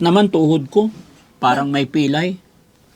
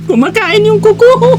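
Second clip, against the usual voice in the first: being tickled; 6 dB louder than usual. Real, not acted. Massage makes her squirm.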